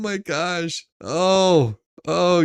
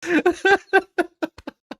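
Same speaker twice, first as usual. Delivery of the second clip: a long high laugh; helpless laughter; 11 semitones higher than usual. Real, not acted. Laughing hard at the story.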